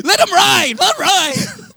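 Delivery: loud, high shout; dad yelling from the ride seat. Retold or imitated. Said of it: "on the seat yelling at him".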